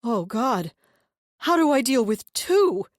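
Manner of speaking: loud, high horrified cry. A narrator's reading. Deluded brother horrified by two demons. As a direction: recoil in terror.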